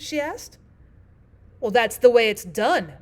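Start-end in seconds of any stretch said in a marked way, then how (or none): 1.6-2.9 loud, decisive Queen; great decision. A narrator's reading. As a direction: state it with firm finality. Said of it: "said with great decision".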